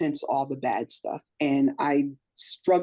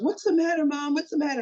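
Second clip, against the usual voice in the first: put-on higher, a child asking what's wrong; son's worried question; 9 semitones higher, faster than usual. Son finding his mom crying, asking what's wrong. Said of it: "He goes".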